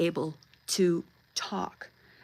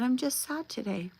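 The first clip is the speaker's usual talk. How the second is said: put-on higher, small child's sad admission; imitating a downcast child, pitched up; sad granddaughter; 6 semitones higher than usual. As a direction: lightly voice a sad little girl. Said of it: "And she said".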